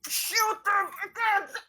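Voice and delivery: high, loud shouted order. Acted. Zoni urging the others to fire, high shout. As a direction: scream the command. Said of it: "Zoni is like".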